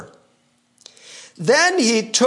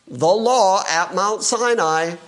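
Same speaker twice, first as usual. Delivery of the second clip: usual speaking voice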